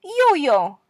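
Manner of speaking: bright demo of "yo-yo", the yo sound; soft yo sound. Shown as a demonstration. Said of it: "just put a little Y sound in front".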